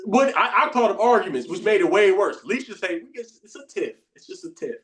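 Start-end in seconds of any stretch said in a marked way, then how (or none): 3.1-4.8 hushed, almost whispered calm; calm wife. Retold or imitated. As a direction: soothe it down as the wife. Wife calmly defusing an argument.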